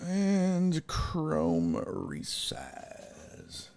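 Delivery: slow, drawn-out whisper; whispered self-talk. Real, not acted. Host resizing a browser window, whispered self-talk. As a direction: whisper each step slowly to yourself. Host murmurs while working the computer.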